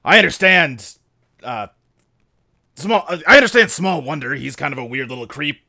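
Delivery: lightly voiced military subordinate; formal report to a superior, dropping off at the end; earnest soldier dog. Plays Rex the soldier dog.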